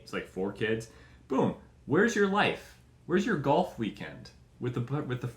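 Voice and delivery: ordinary talk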